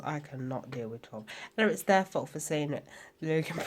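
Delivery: ordinary delivery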